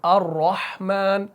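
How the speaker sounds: अर्रहमान with a full, heavy र, slowed. Shown as a demonstration. Teacher showing students the thick ra, slow and heavy. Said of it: "मुंह को आपने भारी करना है".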